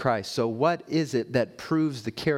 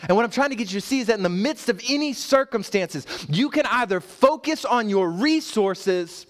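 high, louder preaching rising to a climax; full-force preaching; 6 semitones higher, 3 dB louder than usual. Acted. Pastor urging the church toward faith over resources, climactic preaching. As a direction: build each "in the hands of Jesus" higher.

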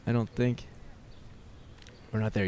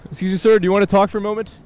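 much higher and louder, hailing a man passing by; high, loud call; 9 semitones higher, 7 dB louder, much faster than usual. Real, not acted. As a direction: hail him high and loud. Calling out to a passerby.

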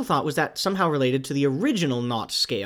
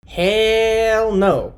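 loud, high, drawn-out refusal; theatrical shout of disbelief; emphatic outburst; 7 semitones higher, 8 dB louder than usual. Pretend. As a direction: belt out a long, high refusal. Conference plans dashed, outburst.

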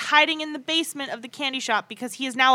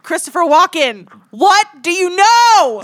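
mock public appeal; 6 semitones higher, 11 dB louder than usual. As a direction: call him out like a pretend interrogator.

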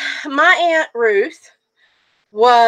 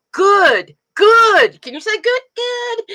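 higher, louder sing-song coaxing; coaching a toddler; 10 semitones higher, 4 dB louder, slower than usual. Retold or imitated. Mother imitating husband coaxing the toddler to say good. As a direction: urge a baby to copy a word. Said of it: "he says".

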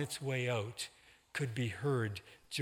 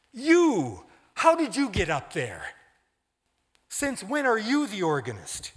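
indignant outburst; 8 semitones higher, 10 dB louder than usual. A narrator's reading. Pastor confronts the organist.

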